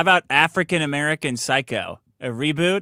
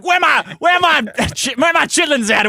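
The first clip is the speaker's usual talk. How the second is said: much higher, loud put-on yell with a laugh; hammed-up caricature; 10 semitones higher, 3 dB louder than usual. Cohost voicing the character hunting for her food, high put-on yell. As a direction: call out searching the apartment.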